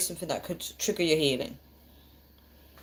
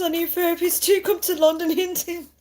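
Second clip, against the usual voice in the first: high, squeaky mock voice reading the comment; lightly voiced viewer comment; reading a comment in a put-on voice; 12 semitones higher than usual. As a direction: read it out in a silly high voice, laughing. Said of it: "I think goes".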